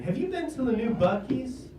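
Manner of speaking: high-pitched, thrilled mom imitation; excited. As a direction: gush the question with rising excitement. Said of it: "Like, excitedly".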